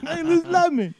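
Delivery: high, loud catchphrase; very high, loud comedian impression; Tracy Morgan. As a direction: call the name high and loud as a caricature. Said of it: "Now just say Liz".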